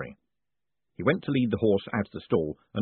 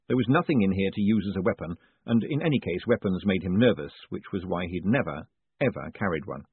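usual speaking voice

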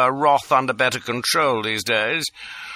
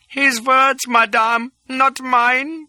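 high squeal of panic; 4 dB louder, slower than usual. A narrator's reading. Little chap squealing at Lola, terrified. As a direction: squeak it out in terror. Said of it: "His voice went up to a squeal".

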